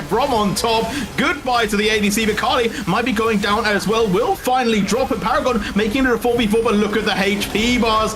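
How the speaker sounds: fast, rising esports call; play-by-play commentary. Acted. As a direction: hype up the teamfight. Live play-by-play of fight.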